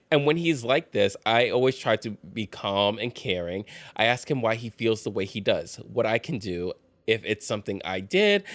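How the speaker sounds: ordinary delivery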